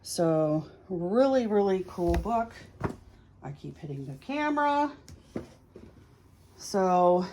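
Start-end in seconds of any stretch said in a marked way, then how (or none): none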